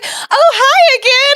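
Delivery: high, slow, loud sing-song hello; bright drawn-out hi again; cheery knowing greeting. Retold or imitated. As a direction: greet her playfully with a knowing lilt. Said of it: "she's like".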